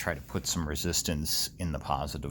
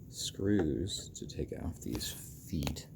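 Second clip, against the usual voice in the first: whispering; 7 dB quieter than usual. Real, not acted. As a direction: whisper it to yourself. Muttered aside while tinkering.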